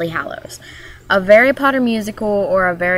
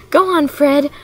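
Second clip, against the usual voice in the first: Weasley twin; 7 semitones higher than usual. Retold or imitated. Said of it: "the, the Weasleys".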